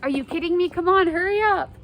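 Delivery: exasperated. Retold or imitated. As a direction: hurry her along, annoyed. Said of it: "And Juliet's like".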